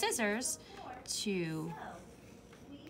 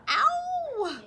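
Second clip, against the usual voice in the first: a sharp, much higher and louder yelp; pained cry; 8 dB louder than usual. A narrator's reading. Lamb bitten on her arm, sharp pained cry. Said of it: "she cried".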